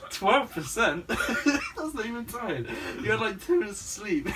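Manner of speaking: high, loud, laughing through the words; laughing disbelief. Retold or imitated. Teasing her brother about his sleep, laughing. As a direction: crack up while mocking him. Teller mocks brother's answer.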